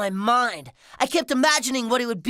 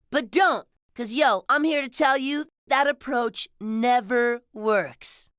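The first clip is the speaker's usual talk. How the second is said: plain reading voice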